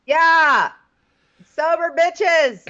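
high, loud, drawn-out cheer. Real, not acted. Cohost cheering for sober friends, loud drawn-out yeah. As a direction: cheer it like a toast.

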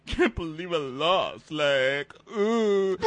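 imitating a tearful loser; mock crying. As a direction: wail it like a sore loser near tears. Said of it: "Please let Karate Kid cry".

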